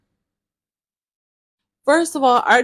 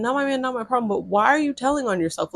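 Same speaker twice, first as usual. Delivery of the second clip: ordinary delivery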